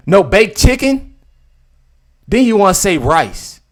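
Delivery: mock outrage at the menu; incredulous. Real, not acted. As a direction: stretch it out in disbelief. Mocking disbelief.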